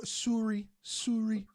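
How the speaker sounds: "Surrey" with a rounded first vowel for "sorry"; Canadian "sorry". Retold or imitated. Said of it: "Even say sorry weird".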